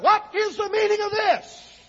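high, loud imitation of a raging husband; enraged demand. Husband confronting wife over the underwear, imitated rage. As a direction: thunder it as a furious challenge. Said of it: "He come out in a rage".

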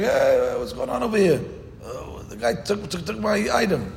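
high, pleading poor man crying out over his stolen lamb; a wronged poor man calling out, voice pitched high; poor man's complaint. Acted. Poor man protesting to the court, high and aggrieved.

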